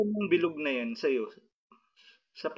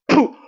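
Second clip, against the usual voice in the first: sharp blowing puff; 14 dB louder than usual. Real, not acted. Healer blows at patient.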